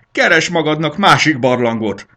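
high, loud shout over the shoulder; shouting back. A narrator's reading. Botorka turns back to shout at Botor, loud dismissive shout. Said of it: "kiáltotta visszafordulva".

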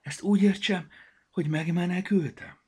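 high, loud little character voice; timid little glasses, hopeful; high-pitched small character asking; glasses Vaksi. Acted.

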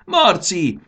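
grandma yelling for her grandson, impatient; impatient shout. Acted. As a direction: call the name sharply, losing patience. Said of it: "Nagymama egyre türelmetlenebből kiabált".

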